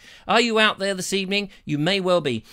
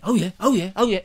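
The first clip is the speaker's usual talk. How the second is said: mock Trump; 4 dB louder, faster than usual. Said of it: "that was Donald Trump".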